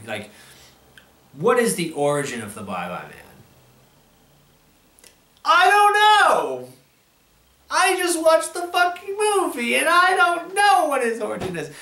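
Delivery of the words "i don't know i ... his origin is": exasperated shouting. Real, not acted. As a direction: shout it in disbelief, voice cracking high. Exasperated yelling about the plot.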